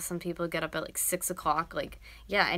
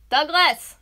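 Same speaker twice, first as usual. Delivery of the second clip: high, very loud name call; yelling; 9 semitones higher, 17 dB louder than usual. Real, not acted. Girlfriend calling sleeping boyfriend, yelling. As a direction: shout to wake a sleeper. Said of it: "I yell at him like that to get up".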